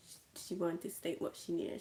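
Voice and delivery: plain reading voice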